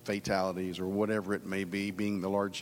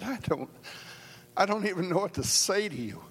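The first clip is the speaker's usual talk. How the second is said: choked-up retelling; 8 semitones higher than usual. Retold or imitated. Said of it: "teary-eyed and choked up".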